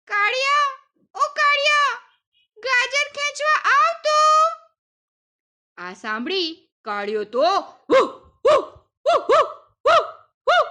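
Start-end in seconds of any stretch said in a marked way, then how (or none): 0.1-4.1 high, loud call to the dog. A narrator's reading. Daughter calls the dog Kaliya to help, high loud call. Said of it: "દીકરીએ તો બૂમ પાડી". 7.9-10.7 high, loud woofing barks; dog barking. A narrator's reading. Kaliya the dog comes running, high loud barks. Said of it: "કરતો આવી પહોંચ્યો".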